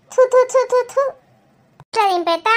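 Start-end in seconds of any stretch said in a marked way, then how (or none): 0.1-1.0 sharp mock spits, higher; ritual spitting. Mother spitting away the evil eye over her daughter, sharp mock spits.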